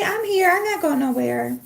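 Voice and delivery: high voice for the dog; voicing the dog. Owner voicing her dog's love, high and sweet. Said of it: "You say".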